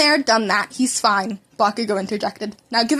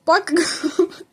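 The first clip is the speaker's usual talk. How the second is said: laughing; 9 semitones higher, slower than usual. Real, not acted. Narrator cracks up mid-reading.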